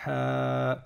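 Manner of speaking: "ha" with a long held a; long vowel. Shown as a demonstration. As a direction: stretch the a of "ha" on a level note. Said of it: "c'est des voyelles longues".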